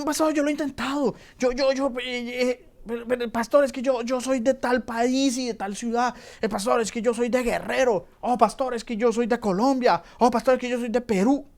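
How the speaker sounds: high, fast, stammering mock believer; whiny excuse-maker. Believer making excuses to the pastor, high and whiny. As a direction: mimic someone pleading excuses to the pastor.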